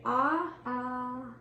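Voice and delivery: open-mouthed ahh with tongue out; saying ahh. Shown as a demonstration. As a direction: demonstrate a long ahh for the exam. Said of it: "stick your tongue out and say".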